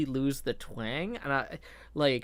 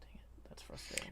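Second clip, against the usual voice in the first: whispered frustration, barely audible; muttered under breath; 18 dB quieter than usual. Real, not acted. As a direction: mutter it to yourself, annoyed. Frustrated muttering while searching for picture.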